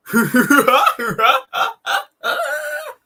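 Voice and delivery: very high, loud hammed-up cackle; mock someone's laugh with a shrill burst of ha-ha-ha; Vegan Gays laugh. Streamer opening an impression of a vegan activist, mock high laugh. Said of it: "working on my Vegan Gays impression".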